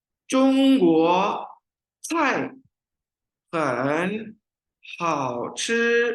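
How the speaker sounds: 中国 菜 很 好吃 read very slowly, each word apart; slow tone drill. Shown as a demonstration. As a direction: break 中国菜很好吃 into slow single words. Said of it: "पढ़ते हैं".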